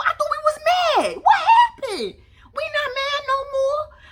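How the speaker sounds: very high squealed mock shock; incredulous outburst. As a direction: yelp it in exaggerated disbelief. Incredulous at friend giving in.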